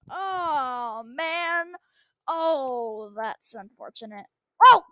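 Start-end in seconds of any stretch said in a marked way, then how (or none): none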